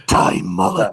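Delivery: low, dramatic trailer announcer; movie trailer voice. Pretend. Host announcing a made-up film title, movie trailer voice. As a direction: drop deep into a movie-promo voice. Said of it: "I'm gonna do the movie voice".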